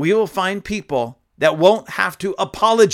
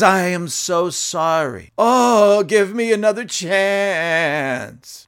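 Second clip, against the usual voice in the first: mock pleading apology; 3 semitones higher, 4 dB louder, much slower than usual. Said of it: "and says".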